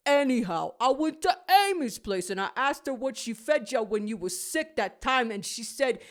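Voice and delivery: a hint of a creaky old walrus; Willy the Walrus. A narrator's reading. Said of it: "hear Willy the Walrus".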